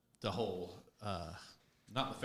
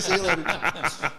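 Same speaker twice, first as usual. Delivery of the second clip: laughing echo; 4 dB louder, slower than usual. Retold or imitated. Echoing the quote while laughing.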